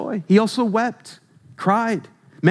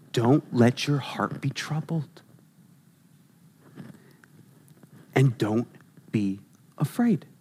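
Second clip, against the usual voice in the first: gentle comfort; 7 semitones lower, 7 dB quieter than usual. Acted. Gently quoting Jesus' comfort.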